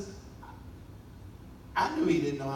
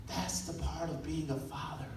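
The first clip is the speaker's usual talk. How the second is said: normal talking voice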